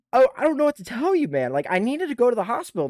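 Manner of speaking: high-pitched, pleading self-justification; exasperated defense. Retold or imitated. Soldier defending his trip to the hospital, high exasperated plea.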